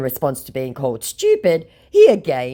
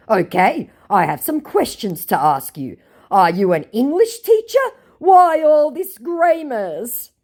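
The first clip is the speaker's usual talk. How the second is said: mock scammer voice; 10 semitones higher, 6 dB louder than usual.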